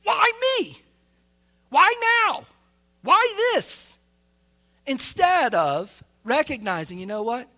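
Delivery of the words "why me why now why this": high, whining mock protest; mock whine of a grumbling believer; complaining to God. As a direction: play the grumbler shaking his fist at God. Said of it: "Shake our fist at him".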